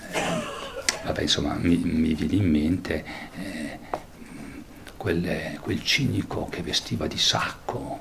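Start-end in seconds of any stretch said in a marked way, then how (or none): none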